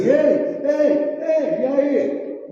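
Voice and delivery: high, repeated hailing shouts; mock shouting. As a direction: yell to get anyone's attention. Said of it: "Ele não sai gritando assim".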